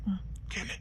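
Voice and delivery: tender whisper. Acted. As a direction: breathe it softly close to her ear. Intimate tender moment.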